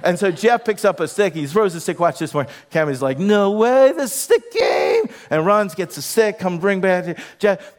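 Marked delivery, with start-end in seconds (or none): 3.2-5.0 acted doggy amazement, slow and very high; thrilled dog. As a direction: pitch soaring, last words drawn out. Acts out dog thrilled by stick.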